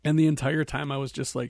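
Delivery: ordinary talk, nothing marked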